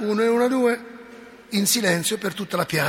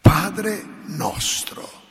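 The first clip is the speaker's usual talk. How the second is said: slow prayer; much slower than usual. Shown as a demonstration. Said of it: "si fermava alla seconda parola".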